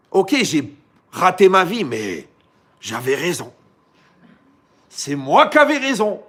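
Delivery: the bitter ex-spouse yelling that being right was all that mattered; loud stubborn self-justification; stubborn outburst. Retold or imitated. Divorced spouse insisting they were right.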